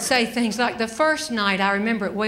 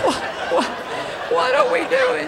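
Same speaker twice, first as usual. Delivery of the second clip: laughing question; 14 semitones higher than usual. Real, not acted. Laughing recalling climb.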